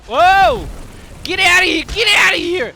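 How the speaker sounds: loud, high excited yelling; excited shout. Real, not acted. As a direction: shout it out in delighted disbelief. Streamer reacts to flashy talent.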